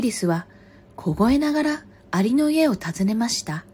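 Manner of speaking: projected storybook reading, phrase by phrase; story narration. A narrator's reading. Reader telling a fox and crow fable, story narration. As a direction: read aloud as to children, with pauses. Reads a picture book aloud.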